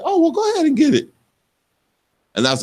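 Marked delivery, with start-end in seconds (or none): 0.0-1.1 high, quick voice for a breezy wife; imitating wife. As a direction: toss it off like an easy yes. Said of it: "and then my wife said".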